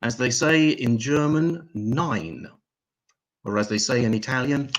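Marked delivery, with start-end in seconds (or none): none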